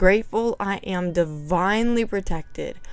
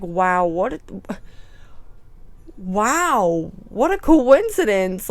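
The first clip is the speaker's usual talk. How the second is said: rising, louder wowed; mock amazement; 3 dB louder than usual. Noticing angel number on the book, mock amazed. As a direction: marvel in exaggeration. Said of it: "And I'm like".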